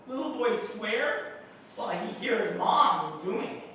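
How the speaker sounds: normal talking voice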